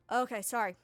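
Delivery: Ravine briefs the party, exasperated and loud; a timid NPC losing patience over the fleeing bandit; frustrated Ravine. Acted. Timid guide Ravine tells the party, exasperated. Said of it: "he goes".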